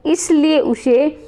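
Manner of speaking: ordinary talk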